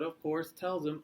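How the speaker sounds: ordinary delivery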